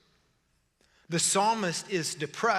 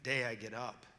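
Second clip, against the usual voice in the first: ordinary talk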